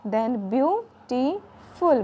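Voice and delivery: Beautiful drawn out, view टी फुल each a beat; syllable demo. Shown as a demonstration. Teacher clapping out the syllables of beautiful for children. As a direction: split beautiful slowly into three beats. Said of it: "demonstrating syllables of beautiful".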